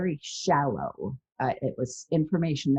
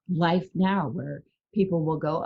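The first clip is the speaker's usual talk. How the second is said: normal talking voice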